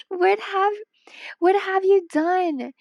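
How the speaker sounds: normal talking voice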